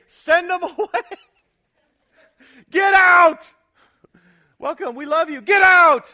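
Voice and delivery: mock blunt dismissal. Preacher mocking the disciples' blunt dismissal of the crowd, hammed-up shout. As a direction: bark it like a bouncer throwing them out.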